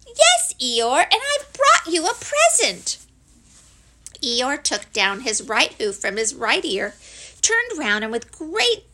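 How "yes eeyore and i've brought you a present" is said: high, loud little voice; squeaky small-creature announcement; eager Piglet. Acted. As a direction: pipe up excitedly, rising high. Piglet eagerly tells Eeyore.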